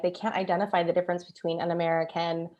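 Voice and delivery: ordinary talk, nothing marked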